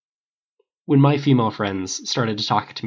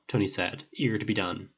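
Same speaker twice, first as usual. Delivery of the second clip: ordinary delivery, nothing marked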